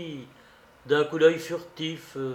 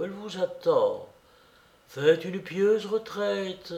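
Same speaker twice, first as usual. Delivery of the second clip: put-on higher-pitched old bishop, pausing between phrases; effortful bishop; 5 semitones higher than usual. A narrator's reading. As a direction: press on with effort, sentence by sentence. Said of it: "L'évêque poursuivit avec effort".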